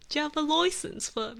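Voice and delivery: high, loud mock British accent. Streamer reading out chat's line, mock British accent. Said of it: "I'm so bad at accents".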